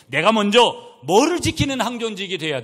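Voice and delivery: loud, high rhetorical challenge; forceful preaching. Real, not acted. Preacher challenging church elders, forceful preaching. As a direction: throw the question out to the whole congregation.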